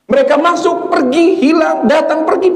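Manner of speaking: high, insistent listing; impassioned preaching. Real, not acted. Preacher warning that loved ones come and go, impassioned. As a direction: hammer out each word up high. Preacher exhorts congregation at height.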